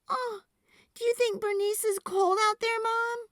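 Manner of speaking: put-on worried little girl. A narrator's reading. Girl asking mom about squash, high and worried. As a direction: fret about the cold. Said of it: "Sophie asked her mother".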